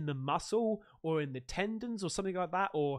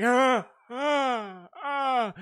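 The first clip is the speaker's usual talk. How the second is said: pained groans; 4 semitones higher, 6 dB louder, much slower than usual. Retold or imitated. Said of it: "And you're like".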